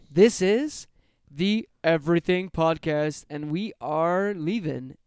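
slow, loud, high show announcer voice; booming mock-announcer goodbye; announcer sign-off. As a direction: stretch it out like a radio host closing the show.

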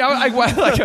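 normal talking voice

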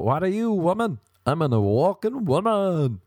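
high, swooping proclamation; hammed-up, singsong self-announcement; mock awoken woman.

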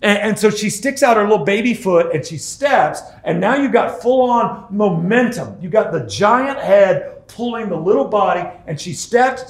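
normal talking voice

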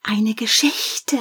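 put-on slow whispered child's plea; children calling. Children begging the storyteller, whispered start. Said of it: "riefen die Kinder".

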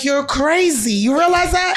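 mock teen disbelief, rising high at the end; son's exasperated quote. Son quoted during argument.

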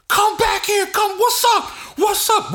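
high, loud yelled taunts; retold screaming. Crew yelling at retreating rivals, loud high taunting. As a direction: holler after them down the street. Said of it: "we all sitting there screaming".

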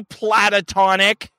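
"platonic" drawn out with an extra syllable; exaggerated "platonic". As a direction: stretch "pla-ta-tonic" slowly and deliberately. Exaggerated correction of earlier mispronunciation.

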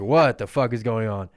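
acted crowd's loud disbelief; loud bewildered outcry. Acted startled crowd in story.

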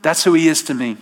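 usual speaking voice

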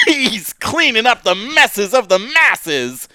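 loud, drawn-out announcer intro; ring announcer. Acted. Big podcast intro, announcer voice.

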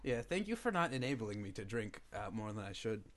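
low, soft deadpan thanks; sarcastic-sounding. Real, not acted. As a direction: meant sincerely but lands as sarcasm. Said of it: "That sounded sarcastic. I meant it".

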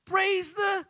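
very high, joyful-joke shout; shouted praise. Acted. Lady finding groceries on her porch, high shout. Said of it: "She shouted".

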